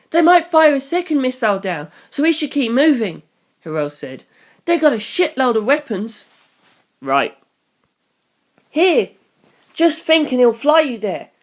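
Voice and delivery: put-on high, urgent boyish voice; urgent teen. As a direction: hurry him along. Voicing Hirose.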